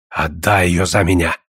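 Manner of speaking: put-on low old man's plea; old suitor. Old man repeats his request.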